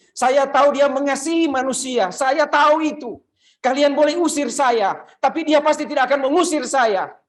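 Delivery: loud, high, pleading cry; desperate plea. Acted. Mother cries out to the disciples, loud and pleading. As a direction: beg with everything, voice lifted high.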